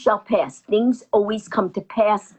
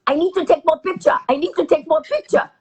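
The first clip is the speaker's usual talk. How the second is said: high-pitched imitation Japanese accent; 7 semitones higher than usual. Japanese passenger demanding more photos, high accented voice. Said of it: "and the Japanese woman".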